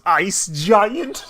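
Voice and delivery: high, loud cutting-in question; hammed-up punchline voice; mock Odin. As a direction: snap it out as an interruption. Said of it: "his dad cuts off and goes".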